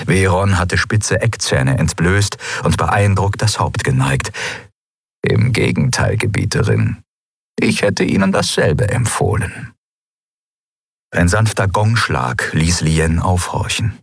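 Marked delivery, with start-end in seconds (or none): 5.2-9.7 deep, respectful minister; a low fanged minister bowing in agreement. Acted.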